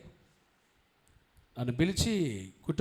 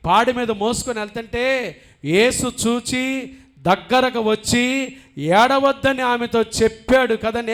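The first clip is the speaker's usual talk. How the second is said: loud, high, fervent preaching; preaching at full force; 9 semitones higher, 12 dB louder, slower than usual. Acted. Pastor proclaims Jesus' comfort to the congregation, fervent full-force preaching. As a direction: lift the congregation with a ringing sermon voice.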